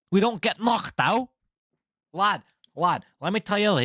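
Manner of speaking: put-on boastful Liverpool lad in a Scouse accent. Posing as a Scouser fighter, bragging in a Scouse accent. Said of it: "I can't do it. I can't hold an accent".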